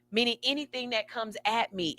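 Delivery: ordinary talk, nothing marked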